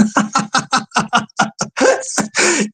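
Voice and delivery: long rattling chuckle; laugh. Real, not acted. Cracking up at the care worker joke, long laugh. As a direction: laugh on and on in short bursts.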